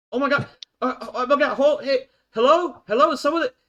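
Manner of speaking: put-on high, loud, flustered teenager; desperate teen calling for help over comms; frantic teen caller. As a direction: stammer it out in a high panic. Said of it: "someone in their, like, early to late teen years".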